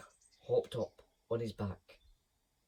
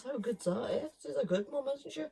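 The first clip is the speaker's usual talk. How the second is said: playful aside; 12 semitones higher than usual. Real, not acted. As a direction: ask it with a lilt. Asides to the child listener.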